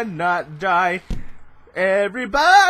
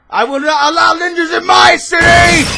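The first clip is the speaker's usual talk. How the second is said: loud, higher roar, hammed-up villain; villain shout; 6 semitones higher, 9 dB louder than usual. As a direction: bellow it like a cartoon villain defending his city. Playing a villain confronting a ninja.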